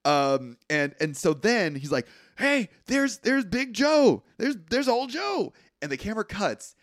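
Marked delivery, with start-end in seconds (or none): 2.4-5.5 excited call. Retold or imitated. Voicing a character spotting the gator.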